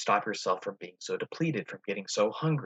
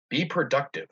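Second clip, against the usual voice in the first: stern good voice; 5 semitones higher, 4 dB louder than usual. Shown as a demonstration. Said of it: "Usually this good voice might be stern".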